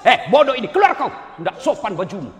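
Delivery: loud angry bark; harsh yelling teacher. Retold or imitated. Rude ustadz yells at badly dressed student, loud and angry. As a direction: shout her out of the room.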